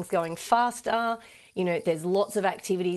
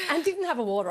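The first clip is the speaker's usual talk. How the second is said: laughing; 11 semitones higher, faster than usual. Real, not acted. Embarrassed after choking on air.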